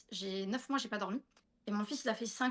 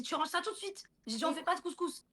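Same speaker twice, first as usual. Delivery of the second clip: mock scolding, pitched higher; telling her daughter off, retold higher; 6 semitones higher than usual. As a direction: scold a child, raised pitch. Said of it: "je l'ai engueulée".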